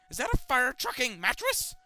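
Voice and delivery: high, loud disbelieving question. Acted. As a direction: yell it in disbelief. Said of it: "I loudly question".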